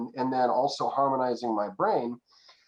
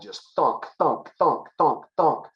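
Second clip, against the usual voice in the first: rhythmic thunk sound effect; imitated thunk; 2 semitones higher, slower than usual. Shown as a demonstration. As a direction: tap it out like a beat. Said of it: "imitating laser hitting gland".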